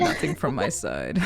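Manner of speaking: low, slow bored drone; low mock drone. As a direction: mumble it like a bored employee. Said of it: "meeting sign-off mimic".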